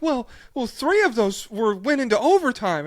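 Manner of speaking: high, loud mock correction; smug fan correcting. Podcaster mocking Twitter fans correcting him, high and loud. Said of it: "they were like".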